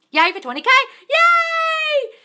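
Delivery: high, loud squealing yay; excited cheer. Real, not acted. Host cheering the twenty K milestone on her live: high, loud yay.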